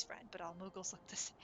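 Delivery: hushed sneaky whisper; whispered aside. Acted.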